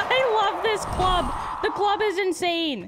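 loud, high, drawn-out enthusiasm; excited shout. Real, not acted. Gamer thrilled with the club, excited shout. Exclaims over club weapon in game.

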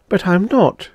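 high-pitched, mildly miserable protest. A narrator's reading. Twilly tells the Devi she is no believer, miserably. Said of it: "Miss Twilly said miserably".

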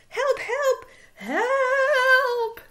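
high, loud cries for help; panicked cries. Acted. Fleeing prairie dog crying for help, high and frantic. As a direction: squeal for help as if running away. Prairie dogs flee the ball.

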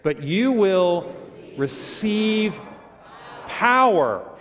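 slow, loud, high group-leading voice; really loud reading. Shown as a demonstration. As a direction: lead a congregation reading aloud, word by word. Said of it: "say that first line really loud with me".

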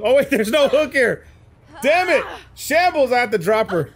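frustrated outburst. Real, not acted. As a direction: blow up in frustration. Killer finds no hook.

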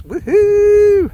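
loud, high celebratory woohoo; excited whoop. Real, not acted. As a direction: let out a big happy cheer. Cheering about recycling cans.

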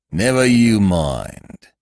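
slow, severe constable's warning; a policeman putting a nosy stranger in his place. A narrator's reading. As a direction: drag it out, stern and heavy. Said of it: "he said severely".

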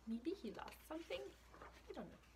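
breathy questioning whisper; secretive whispered wondering. Real, not acted. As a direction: lean in and whisper the guess. Guessing aloud, hushed.

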